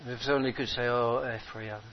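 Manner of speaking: hushed sorrowful preaching. Acted. Preacher mourning those who left, hushed and sad.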